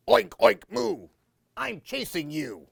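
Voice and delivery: hammed-up oinking monster; mock monster. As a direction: play the silly pig-cow monster. Uncle playing monster.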